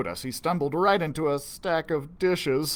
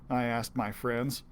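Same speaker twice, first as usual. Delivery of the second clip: plain reading voice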